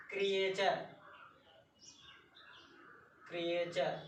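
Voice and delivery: teacher models क्रिएचर as kree-char; model pronunciation. Shown as a demonstration. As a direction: say क्रिएचर clearly with cha sound, kree-char. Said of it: "ऐसे ही आगे देख लेते हैं".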